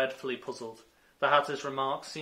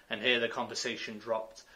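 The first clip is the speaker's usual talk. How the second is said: ordinary delivery, nothing marked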